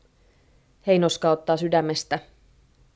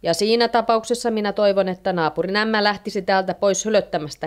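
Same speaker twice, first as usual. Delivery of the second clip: ordinary delivery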